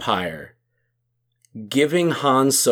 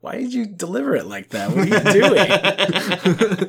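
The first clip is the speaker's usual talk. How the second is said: laughing; 6 semitones higher than usual. Real, not acted. Mocking a line delivery, laughing.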